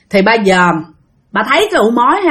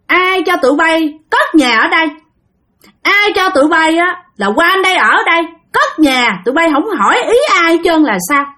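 put-on higher, scolding old man's voice; a cranky spirit telling off intruders; old ghost scolding the father for building there; angry old man; 7 semitones higher than usual. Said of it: "Ổng nói vậy nè".

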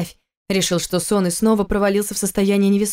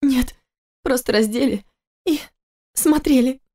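высокий женский голос, мягко возражает; жена успокаивает мужа; жена оправдывает; 5 semitones higher than usual. Acted. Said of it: "снова начала всхлипывать". (высоко, мягко)

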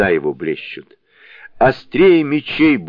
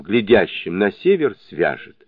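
ordinary delivery

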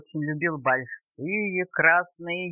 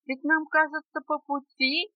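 high, tiny bug voice; piping, squeaky request; squeaking little beetle; 7 semitones higher than usual. A narrator's reading. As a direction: squeak it up high like a small insect. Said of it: "опять пропищал жучок".

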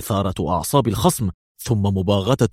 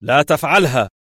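high, raised-voice command; urgent call to halt; shouted warning; 6 semitones higher, 4 dB louder than usual. A narrator's reading. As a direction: shout to stop him. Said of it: "صاح ليفي مرة أخرى".

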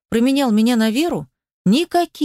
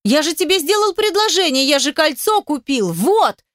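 high-pitched aggrieved yell; indignant, pleading outcry at moderate volume; 6 semitones higher than usual. A narrator's reading. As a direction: holler it high through a closed door. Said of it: "орёт он за дверью".

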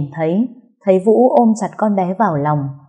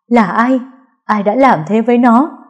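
louder, higher outburst; angry demand; 5 semitones higher, 5 dB louder than usual. Acted. Sister demanding who hurt the girl, loud and angry. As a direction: demand the culprit's name, voice rising. Repeats question, enraged.

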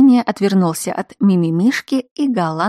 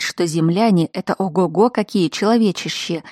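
usual speaking voice

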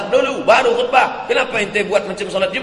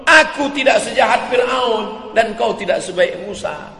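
firm retort; 6 semitones higher, 4 dB louder than usual. Acted. Caliph answers the rude man.

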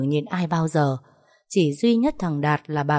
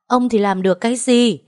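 higher-pitched scornful outcry; exasperated raised-voice challenge; wailing yell; 5 semitones higher, 4 dB louder than usual. A narrator's reading. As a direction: lash out at a husband through tears, acted. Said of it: "Bà ngoan lại gào lên rồi khóc".

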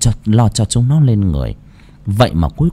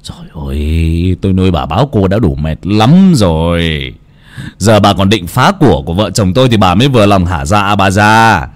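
growling Kha. A narrator's reading. Son berating his burdensome old mother, roaring. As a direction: roar bitterly, stretching the line endings. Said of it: "cũng nhìn mà gầm".

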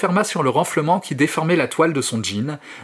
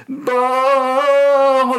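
very high, loud strangled shriek; cut-off scream; 11 semitones higher, 5 dB louder than usual. Acted. Kid screaming as the jeans tear, very high shriek. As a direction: scream cut short by pain.

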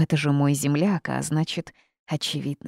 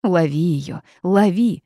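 urgent, louder cry; sudden shout; 6 dB louder than usual. A narrator's reading. Bird ordering her to catch the tear, shouting. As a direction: call out the order sharply. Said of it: "внезапно закричал Птиц".

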